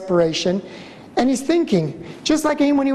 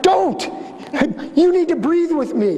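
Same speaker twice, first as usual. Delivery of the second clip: sharp and higher, a mock outburst; imitating wife's outburst; 6 semitones higher than usual. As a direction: fire it off like the frantic wife he's quoting. Wife snapping at husband in labor.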